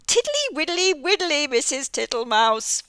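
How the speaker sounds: high, loud toad sing-song. Acted. Mister Jackson the toad answering Missus Tittlemouse cheerfully. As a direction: chant it like a jolly toad.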